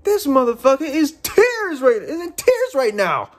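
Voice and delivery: high-pitched exasperated outburst; incredulous. Real, not acted. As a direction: exclaim it high, in indignant disbelief. Indignant rant about the scene.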